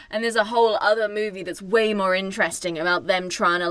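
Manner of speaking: normal talking voice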